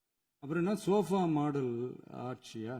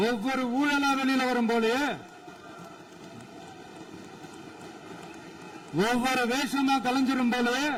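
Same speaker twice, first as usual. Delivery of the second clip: taunt; 6 semitones higher than usual. Real, not acted. Taunting rivals in a sing-song.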